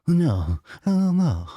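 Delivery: high mock wail of dismay. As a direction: imitate a whiny gadget owner losing it. Mocking owner panicking over scratch.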